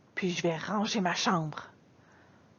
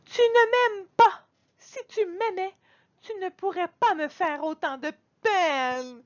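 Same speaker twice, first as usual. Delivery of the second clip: high wailing reproach; crying out; 11 semitones higher, 3 dB louder than usual. Acted. Girl crying out at her father, high and loud. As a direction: shriek it like a hurt child. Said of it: "Je me mets à crier".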